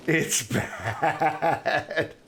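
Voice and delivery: real laugh breaking into the phrase. Real, not acted. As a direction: laugh through the words, amused and embarrassed. Laughing over own voice crack.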